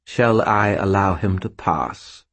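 slow, cold disdainful spirit; unhurried sneering servant; contemptuous skull voice. Acted. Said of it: "the contempt in its tone".

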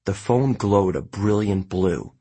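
ordinary delivery

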